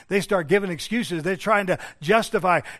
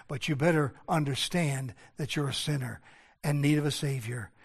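low, hushed pulpit voice; solemn preaching; 4 semitones lower, 7 dB quieter than usual. Real, not acted. Preacher warns congregation that God is holy, low solemn preaching. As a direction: drop low and soft, sober warning.

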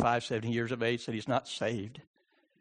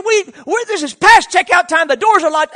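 high, loud shouted rebuff; shouted argument; 16 dB louder than usual. Retold or imitated. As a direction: shout him down with the rules. Acts out man shouting back.

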